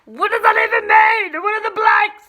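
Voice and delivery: loud comic outburst; over-the-top exasperated shout; mock freak-out. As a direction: yell it in exaggerated bafflement. Mock panic over blanks.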